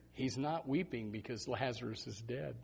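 usual speaking voice

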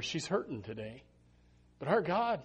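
ordinary talk

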